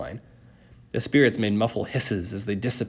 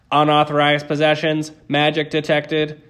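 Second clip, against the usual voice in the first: loud, raised, level machine voice; alarm announcement; 5 semitones higher, 6 dB louder than usual. A narrator's reading. Detector catching intruders, blaring alarm. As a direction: blare it like a security alarm.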